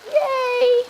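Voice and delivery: high happy little cheer; excited cheer. Real, not acted. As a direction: celebrate the creamy rice. Creamy texture appears.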